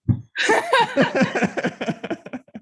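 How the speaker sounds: high, long giggling fit; burst of laughter. Real, not acted. Teasing a friend stuck for English words, high laughter.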